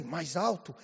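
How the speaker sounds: low, softened questioning echo; son's doubtful echo. Retold or imitated. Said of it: "Aí eu falei assim".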